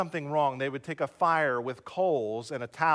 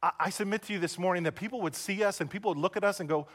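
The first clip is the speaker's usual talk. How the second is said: usual speaking voice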